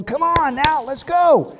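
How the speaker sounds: high, urging yell; mock scream. Preacher urges the clueless disciples, exasperated holler. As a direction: holler at them to hurry up and see it. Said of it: "wanna scream at these people".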